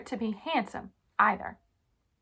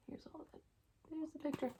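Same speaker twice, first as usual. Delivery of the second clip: hushed aside; 5 semitones higher, 14 dB quieter, faster than usual. Real, not acted. Aside while showing book.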